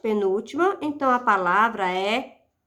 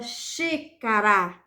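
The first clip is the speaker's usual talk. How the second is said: "xícara" slowly, starting on a strong "xí"; stress on antepenultimate syllable; 2 semitones lower, much slower than usual. Shown as a demonstration. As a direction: hold the "xí" in "xícara". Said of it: "sílaba tônica". ("xícara" devagar, com "xí" forte)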